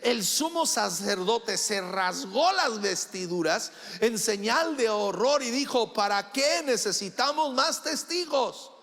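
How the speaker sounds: loud, high, driving pulpit voice; full-force preaching. Acted. A preacher thunders at his congregation, loud and high. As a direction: preach it hard to the whole church.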